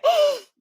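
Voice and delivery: loud, high fake gasp of delight; mock gasp. Pretending to be wowed by a baby, loud high gasp. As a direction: gasp as if the baby stuns you.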